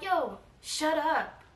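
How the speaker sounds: slow, stretched-out telling-off; drawn-out shut up. Retold or imitated. Telling a yelling friend to shut up, slow and drawn out. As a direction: draw out each word to silence someone. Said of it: "I told her just like that".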